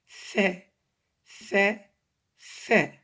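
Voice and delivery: "fait" as Arabic tha, tongue tip out, each one separate; tongue-tip sound. Shown as a demonstration. Teacher models the letter after explaining it, tongue-tip demo. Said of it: "on sort le bout de la langue".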